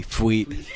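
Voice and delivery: "Fweet" said with a soft f-w onset, falling tune. Shown as a demonstration. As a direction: spell it out in sound: "Fweet", f then w. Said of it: "P H W E E T".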